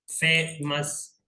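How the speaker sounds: फेमस very slowly, with a gap before मस; teacher spacing out both halves of फेमस; two separate syllables. Shown as a demonstration. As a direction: split फेमस into फे and मस. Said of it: "जिसमें दो syllable हो".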